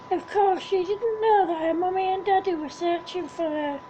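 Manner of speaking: usual speaking voice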